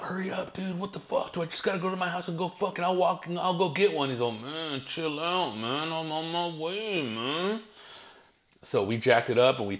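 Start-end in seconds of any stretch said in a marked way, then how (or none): 0.0-4.1 high-pitched, mock-frantic hurrying; freaking out. Stuck driver pleading with his late homie, freaking out. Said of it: "I'm over here freaking out". 4.3-7.6 high, drawn-out lazy drawl; laid-back homie. Retold or imitated. Homie Rob telling his frantic friend to wait, laid-back.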